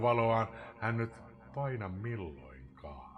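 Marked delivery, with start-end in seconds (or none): 1.5-3.1 slow, hushed whisper; despairing whisper. Acted. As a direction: let the line die into a whisper. Poem's despairing close of stanza.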